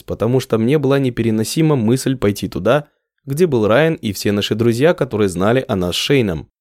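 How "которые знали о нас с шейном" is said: ordinary delivery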